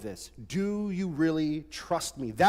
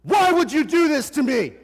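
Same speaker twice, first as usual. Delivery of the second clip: higher, louder yell at mom; teen tantrum shout; 9 semitones higher, 9 dB louder than usual. Retold or imitated. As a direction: shout like an angry fourteen-year-old. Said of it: "And I go".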